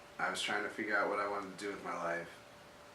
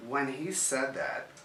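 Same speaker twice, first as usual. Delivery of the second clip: normal talking voice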